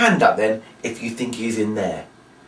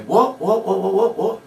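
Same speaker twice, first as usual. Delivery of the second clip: mock confusion; 8 semitones higher than usual. Shown as a demonstration. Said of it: "And you say".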